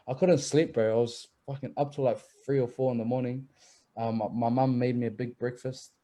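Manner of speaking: ordinary talk, nothing marked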